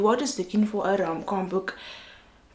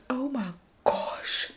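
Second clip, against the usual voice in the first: slow, higher whisper with a catch of crying; tearful whisper; 4 semitones higher, 3 dB quieter, slower than usual. Real, not acted. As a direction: let it break into a near-sob. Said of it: "I just feel like crying".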